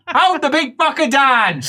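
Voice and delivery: high-pitched order; rally the others to restrain him; bossy helper. Acted. Helper ordering others to pin patient, acted commanding voice.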